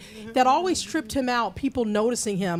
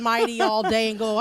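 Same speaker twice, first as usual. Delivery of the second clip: normal talking voice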